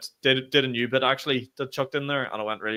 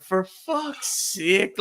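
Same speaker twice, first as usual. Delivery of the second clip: dismayed groan; 7 semitones higher, much slower than usual. Retold or imitated. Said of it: "I was like".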